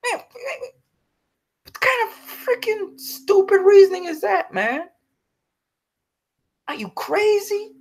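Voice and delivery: real exasperated fury at the studio; furious outburst. Real, not acted. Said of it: "I am just very angry".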